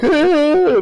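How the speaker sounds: high, loud fake sob; mock wail. Shown as a demonstration. Fan showing how he didn't cry, high mock wail. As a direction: demonstrate an exaggerated bawl. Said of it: "I didn't, like".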